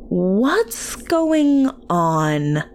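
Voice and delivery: drawn-out, questioning call; slow, stretched "what's going on"; aunt calling out. A narrator's reading. Aunt to frantic daycare worker, calling out.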